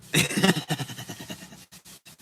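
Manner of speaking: loud unvoiced laugh; breathy laugh. Real, not acted. Laughing at his own joke, loud and breathy. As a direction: burst out laughing.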